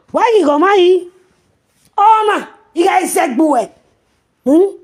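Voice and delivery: high, loud taunting yells; scornful shouting. Real, not acted. Mocking challenges at the woman.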